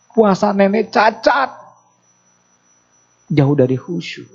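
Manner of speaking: high, fervent sermon lament; impassioned preaching. Acted. Preacher pressing the lesson on the congregation, fervent and high. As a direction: preach it with rising fervour, as if near tears.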